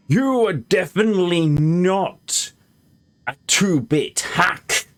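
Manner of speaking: slow, theatrical declaiming; hammy, deliberate self-praise; grand affirmation. Acted. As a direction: draw out each phrase like a stage speech. Performs in-game lines as a declaimed affirmation.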